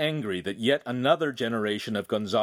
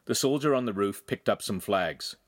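ordinary delivery

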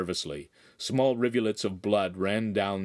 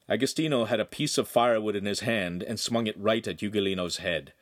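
usual speaking voice

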